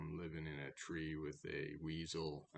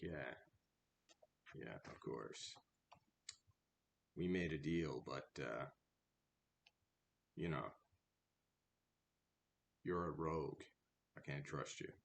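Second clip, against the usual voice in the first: dry sarcasm; 4 semitones lower than usual. Acted. Rat drily refuses the cat.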